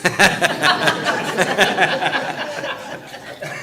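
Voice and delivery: a long, real burst of laughter. Real, not acted. Laughing at the church family over the phone mix-up. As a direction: laugh it out at the funny memory.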